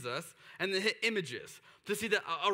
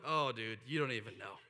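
much lower, softer, knowing Jesus voice; 12 semitones lower, 6 dB quieter than usual. Retold or imitated. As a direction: drop deep and quiet, all-knowing.

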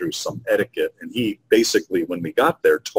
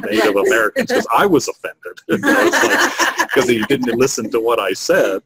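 higher, louder, talking through laughter; laughing; 5 semitones higher, 4 dB louder than usual. Real, not acted. Business host joking about a client who ignored advice, laughing. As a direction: laugh while getting the words out. Speaker laughs at own offended reaction.

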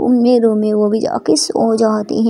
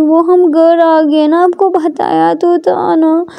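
slow, higher, drawn-out excuse; sheepish explaining; 5 semitones higher, slower than usual. Acted. Ishani explains she came home, placating. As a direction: stretch out the excuse to placate her.

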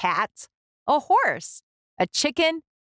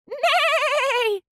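much higher, long held neigh; whinny like a horse. A narrator's reading. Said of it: "said Horse".

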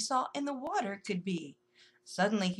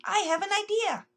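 excited announcement; 10 semitones higher, 5 dB louder than usual. Acted. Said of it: "Melvin announced".

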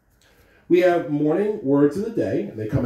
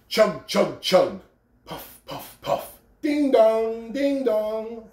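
train sounds; 7 semitones higher, much slower than usual. A narrator's reading. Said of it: "The little train rumbled".

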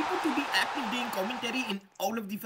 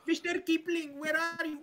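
higher, hammed-up Indian accent; 6 semitones higher, 5 dB quieter than usual. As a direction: play a call-center guy with an Indian accent. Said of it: "Now he sounds Indian".

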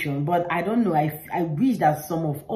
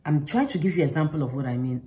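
normal talking voice

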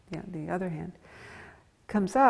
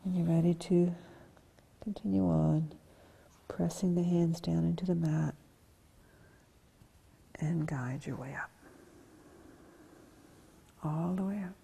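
soft, whispered resting cues; soft yoga guidance; 2 semitones lower, 4 dB quieter than usual. Acted. Yoga teacher guides class through rest, soft and whispery. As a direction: breathe the cues out gently. Calm cues in forward fold.

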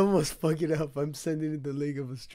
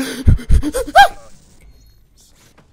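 startled gasp; 13 semitones higher, 18 dB louder than usual. Real, not acted. Sudden scare while breaking glass.

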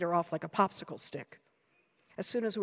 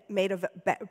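ordinary delivery, nothing marked

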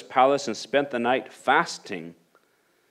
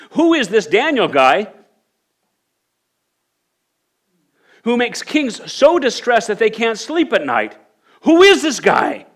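building, ever higher and louder question; rising excitement; 10 semitones higher, 6 dB louder than usual. Real, not acted. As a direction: build the wonder until it nearly shouts. Preacher's rising rhetorical question.

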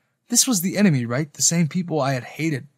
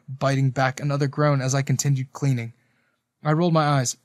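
plain reading voice

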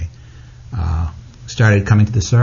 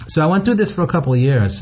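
normal talking voice

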